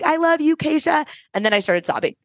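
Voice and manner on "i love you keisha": high, gushing imitation; retold greeting, pitched well up; imitated guest greeting. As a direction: re-enact a guest calling affection from the back. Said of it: "she's like".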